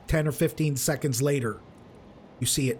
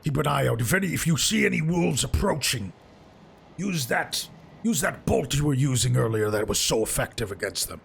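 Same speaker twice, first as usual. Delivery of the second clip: Zal. Acted. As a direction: instruct the lookout in a raised-pitch companion voice. Game master plays Zal.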